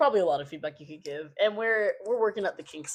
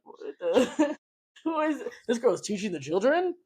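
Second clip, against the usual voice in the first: higher, incredulous imitation of a listener; mock disbelief; 4 semitones higher than usual. Podcaster imitating listeners shocked at who teaches children, mock disbelief. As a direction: put on mild scandalized disbelief through a laugh.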